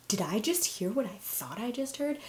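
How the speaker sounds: lightly acted protective indignation; mock dad rage. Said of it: "full dad rage came out".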